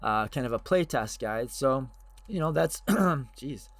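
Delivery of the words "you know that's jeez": usual speaking voice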